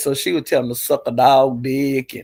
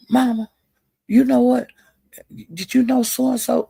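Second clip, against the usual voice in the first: higher voice, eager tattling; child telling on siblings; 8 semitones higher than usual. Retold or imitated. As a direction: tattle to Mama in a raised voice. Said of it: "I would say".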